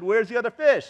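high, loud mock-indignant father; upset demand. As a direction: imitate an upset dad's complaint. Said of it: "he got upset".

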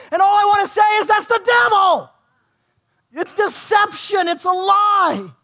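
escalating pulpit outcry, stretched out at the climax; impassioned preaching. Real, not acted. Preaches at full force.

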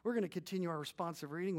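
usual speaking voice

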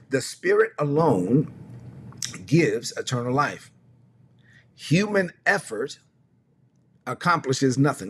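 slow, drawn-out scripture reading; czytanie Pisma. Acted. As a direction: read the verse deliberately, pausing between phrases. Reads scripture aloud, slowly.

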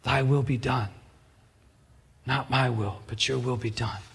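soft, hushed prayer; a hushed prayer of surrender; quiet prayer. Shown as a demonstration. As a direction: pray it quietly and humbly. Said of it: "as we are to pray".

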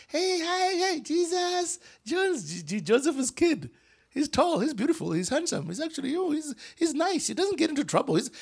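a hint of a chatty village woman; villager greeting. Villagers greeting Joseph's kid in the village, high and light.

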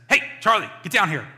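very loud, high-pitched scolding; yelling. Retold or imitated. Owner to puppy gone upstairs, yelling. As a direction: yell orders up the stairs. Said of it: "And I just yelled".

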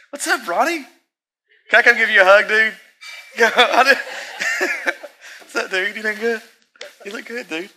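high, bright-eyed hello to a friend who just walked in; excited greeting. Real, not acted. Preacher greeting his friend Ronnie, excited and high.

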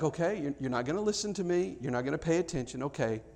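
put-on giving up on a child who won't listen; resigned parent. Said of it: "when you're just like".

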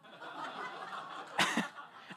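breathy laugh; real laugh. Real, not acted. Laughing at the hug request.

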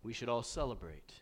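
low, hushed, lifeless mock Jesus; stoic Jesus. Preacher mocking a joyless Jesus, low and soft monotone. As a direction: drone it out with no joy. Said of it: "I don't see Jesus going".

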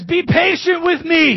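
high, loud desperate pleading; begging servant. Acted. Servant begging the king for patience, high pleading. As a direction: beg the king for mercy on your knees. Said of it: "he begged".

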